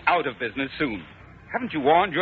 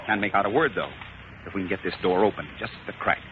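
softer, lower, holding the voice down; hushed; 8 semitones lower, 7 dB quieter than usual. Acted. Colonel hushes the cadet outside the enemy's door. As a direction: keep it down so nobody hears.

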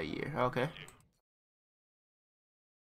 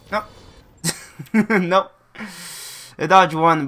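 excited; 5 semitones higher, 7 dB louder than usual. Real, not acted. Enemy dodges railgun, laughing.

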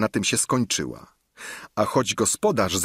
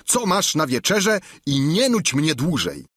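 higher, louder squire's voice; a plain-spoken squire, higher and louder; Sancho's voice; 10 semitones higher, 3 dB louder than usual. Acted. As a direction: Sancho voiced high and loud. Sancho pressing the innkeeper.

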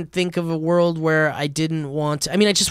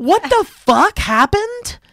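much higher, slow stunned question; shocked; 14 semitones higher, 3 dB louder, slower than usual. Real, not acted. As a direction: gasp it out in disbelief. Shocked reaction to story.

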